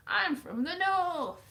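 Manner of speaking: high, loud, slow mock Northern English; attempted Northern accent. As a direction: declare it in a bad Northern accent. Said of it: "I don't know what accent that was".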